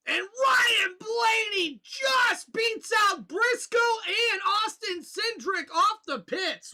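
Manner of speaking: loud, high race call; excited play-by-play. Acted. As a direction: call the pit stops like a hyped announcer.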